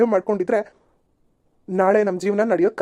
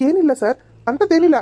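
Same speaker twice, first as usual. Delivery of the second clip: high, louder, rushed denial; panicked denial; 6 semitones higher, 4 dB louder than usual. Acted. Man tells the policeman nothing is wrong, panicked. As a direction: insist nothing happened, flustered.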